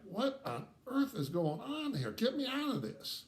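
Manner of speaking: high-pitched mock alarm; exasperated bewilderment. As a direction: act out wanting to escape, pitch climbing. Acted panic at the close embrace.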